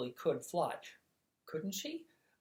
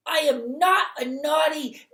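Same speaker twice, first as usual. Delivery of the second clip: yelling; 12 semitones higher, 10 dB louder than usual. Acted. Twinkle yelling his innocence from far off, high and loud. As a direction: yell it like an indignant little boy.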